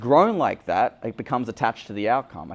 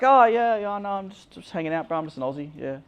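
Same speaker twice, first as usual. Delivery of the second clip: awkward dodge; 9 semitones higher than usual. Retold or imitated. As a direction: fumble a lame excuse. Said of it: "I'm like".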